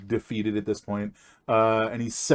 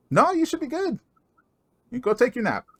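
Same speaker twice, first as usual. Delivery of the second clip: high, bright-pitched dwarf voice sending him off; cheery dwarf Yastrek; 14 semitones higher than usual. Acted. Dwarf sends his tired son off to nap, voiced high. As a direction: pitch way up and shoo him to bed.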